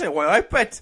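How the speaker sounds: hammed-up Geordie catchphrase; mock Geordie. Contestant falling back on one phrase, mock Geordie accent. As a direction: repeat it in a bad Newcastle accent. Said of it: "just gonna keep saying this".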